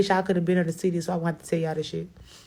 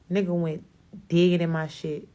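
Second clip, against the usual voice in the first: usual speaking voice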